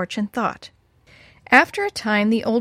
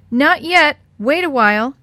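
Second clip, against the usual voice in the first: higher, louder call back; brushing mother off; 5 semitones higher, 6 dB louder than usual. Acted. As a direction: put mother off, raised voice. Alice calls back to her mother.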